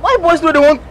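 high, loud protest; loud outburst. Acted. Friend complains about her gossip, loud exasperated outburst.

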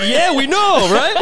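high, loud mock-chummy agreeing; hammed-up eager nodding along; mock eager agreement. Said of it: "and we're just like".

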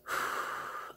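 long, soft exhaled sigh; weary sigh. Real, not acted. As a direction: let out a quiet, voiceless sigh before carrying on. Sighs before tackling the aggressive mother.

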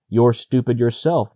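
plain reading voice

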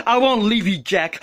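ordinary talk

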